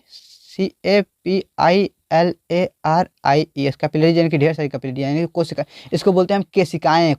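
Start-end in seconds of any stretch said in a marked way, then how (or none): 0.1-3.8 slow, letter by letter spelling; spelled out letter by letter. Shown as a demonstration. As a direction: spell CAPILLARIES slowly. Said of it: "क्या बोलेंगे?".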